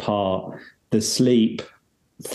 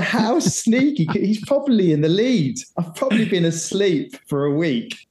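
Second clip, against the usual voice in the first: much higher, mock-dramatic inner voice; mock outrage; 8 semitones higher than usual. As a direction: play up the outrage, way up high. Retold panicked thought after waking.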